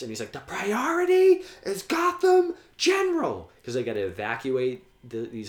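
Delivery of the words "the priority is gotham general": very high, loud commanding yell; imitated urgent shout. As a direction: act out a police chief shouting orders. Acting out Gordon's urgent order.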